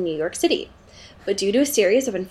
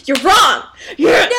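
gleeful shout; 8 semitones higher, 6 dB louder, slower than usual. Real, not acted. Gloating over friend's wrong guess.

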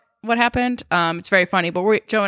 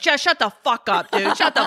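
higher-pitched mock exasperation; retold pissed-off snapping; 5 semitones higher than usual. Imitating everyone in the car at Jess, higher, mock pissed-off. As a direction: imitate an irritated group telling someone off. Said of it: "everyone's so pissed off".

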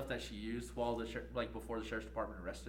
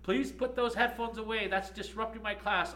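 high, loud, slow scolding teacher; imitating the professor scolding a student; stern teacher; 9 semitones higher, 6 dB louder than usual. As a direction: play the teacher ordering her to stop. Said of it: "Miss Harvey's like".

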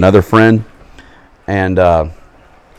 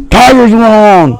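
dad cheering; 13 semitones higher, 5 dB louder, much slower than usual. Retold or imitated. Dad cheering after game.

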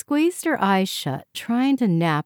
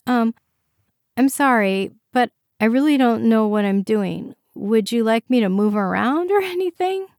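higher, louder character voice, rising into the question; unsure, apologetic patient; 5 semitones higher, 5 dB louder than usual. A narrator's reading. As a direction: apologize awkwardly and offer to help.

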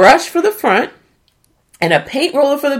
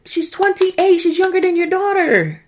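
much higher, pleading disbelief; exasperated disbelief; 12 semitones higher than usual. Real, not acted. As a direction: plead in high-pitched exasperation. Exasperated about the age gap.

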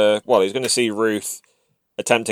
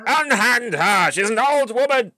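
hammy stage actor; 10 semitones higher than usual. Acted. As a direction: declaim it like an old stage hero. Orc defending his mistress.

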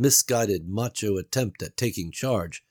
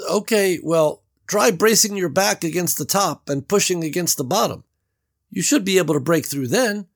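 higher, louder doubtful Beverly; Beverly's voice; 10 semitones higher, 6 dB louder than usual. A narrator's reading. Beverly doubts Gary's escape plan, worried and raised. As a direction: voice her worry, raised.

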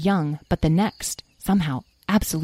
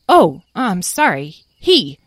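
louder, flustered self-correction; hasty apology; 6 dB louder, slower than usual. Acted. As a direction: correct yourself in embarrassment. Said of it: "Tom said hastily".

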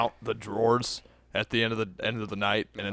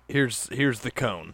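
slow deadpan; slower than usual. Retold or imitated. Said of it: "I'm like".

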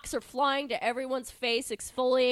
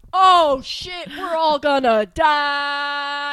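loud, slow hammed-up terror; panicked crowd about to die; mock panic; 3 semitones higher, 11 dB louder, much slower than usual. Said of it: "everyone's like".

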